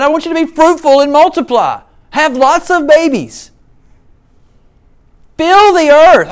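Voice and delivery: commanding God voice, rising to the end; booming voice of God. Acted. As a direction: proclaim it as God speaking. Preacher voicing God's command.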